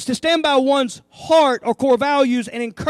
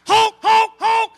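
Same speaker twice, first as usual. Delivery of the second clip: loud, higher honking voiced as words; mock goose honks; 7 semitones higher, 5 dB louder, slower than usual. A preacher playing the geese, honking loud and higher. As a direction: honk like a goose, hammed-up. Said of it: "They're in the back honking".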